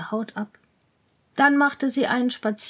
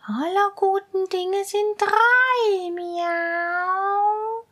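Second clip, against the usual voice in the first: higher, slow cat voice; coaxing cat; 8 semitones higher, much slower than usual. Acted. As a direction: sweet-talk like a scheming cat. Cat coaxes.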